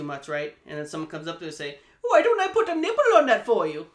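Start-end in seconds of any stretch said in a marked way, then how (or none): 2.0-3.9 shrill mocking falsetto, loud; mocking high voice. Mocking a slow drinker at party.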